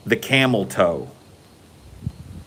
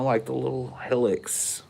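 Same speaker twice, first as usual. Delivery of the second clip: whisper; 10 dB quieter than usual. Retold or imitated. Whispering about girlfriend's body.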